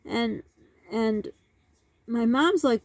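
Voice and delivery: ordinary talk, nothing marked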